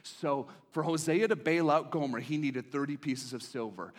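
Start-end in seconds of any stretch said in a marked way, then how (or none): none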